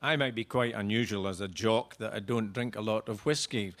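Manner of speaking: ordinary delivery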